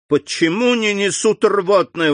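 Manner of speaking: high, impatient outcry; urgent exclamation. A narrator's reading. Doctor waits for the emetic, high impatient exclamation. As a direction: demand it urgently, pitched high. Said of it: "воскликнул доктор".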